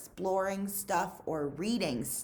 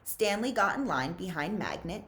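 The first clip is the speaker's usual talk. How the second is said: ordinary delivery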